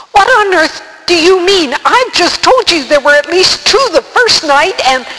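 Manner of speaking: put-on high, loud, indignant boy; exasperated protest. A narrator's reading. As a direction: protest loudly, pitch way up.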